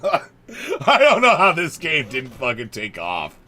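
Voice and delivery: laughing through the words. Real, not acted. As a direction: say it mid-laugh, amused. Repeats line while laughing.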